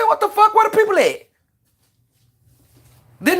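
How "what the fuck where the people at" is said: retold shocked disbelief. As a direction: squeal at the missing staff. Drive-thru computer, no staff.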